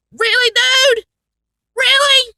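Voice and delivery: high, loud incredulous disbelief; can you believe this; really fed up, pitched high; exasperated. Real, not acted. Exasperated comment on long wait.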